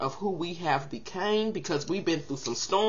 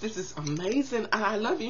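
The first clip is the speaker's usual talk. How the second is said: gushing delight; 2 semitones higher, 5 dB louder than usual. Pretend. Vlogger imagines getting a kiss in a box and squeals with joy. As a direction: gush over the tiny gift box like it's the best thing ever. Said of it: "I'm gonna be like".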